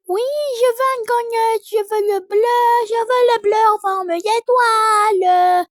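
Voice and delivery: put-on high, loud child insisting on the blue donut; demanding toddler voice. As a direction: whine for the star one.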